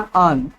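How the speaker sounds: अन said short and weak, with a schwa. Shown as a demonstration. Teacher to Bihar students, showing how an really sounds. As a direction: say अन lightly and quickly, with no full a. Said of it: "हकीकत में इसको क्या पढ़ना है".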